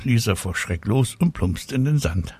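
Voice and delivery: usual speaking voice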